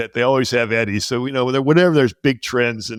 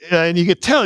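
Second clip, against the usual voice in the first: ordinary talk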